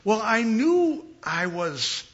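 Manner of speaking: usual speaking voice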